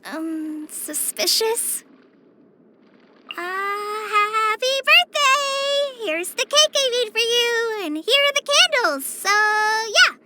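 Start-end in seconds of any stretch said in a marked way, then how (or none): 3.3-10.1 high, drawn-out birthday cheer; awkward celebration. Acted. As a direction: announce it brightly but unsure of yourself. Awkwardly presents a birthday surprise.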